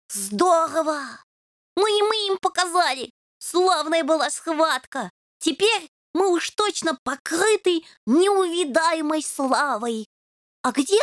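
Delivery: high, delighted boasting; thrilled about the battle; excited kitten. A narrator's reading. Ryzhy cheers victory. (восторженный котёнок)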